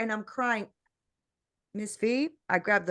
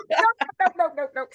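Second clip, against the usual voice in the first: high, loud protest through laughter; mock panic breaking into a laugh; laughing refusal; 6 semitones higher, 5 dB louder, faster than usual. Woman retells balking at her vision, laughing in mock alarm.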